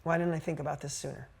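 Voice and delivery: soft, subdued quote; hushed, understated emotion; tearful realization, retold. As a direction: quietly voice a man moved by what he just realized. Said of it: "he got tears in his eyes".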